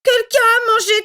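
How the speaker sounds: little bear crying over his eaten porridge; Baby Bear whimpering. A narrator's reading. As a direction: whimper like a tiny bear cub. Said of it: "pleurnicha Bébé Ours de sa toute petite voix".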